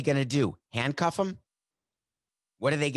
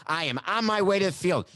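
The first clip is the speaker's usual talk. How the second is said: high-pitched defiant declaration; insist, voice jumping high; emphatic insistence; 7 semitones higher, 3 dB louder than usual. Acted. Player arguing past security to reach the field, emphatic. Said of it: "Was that emphatic?".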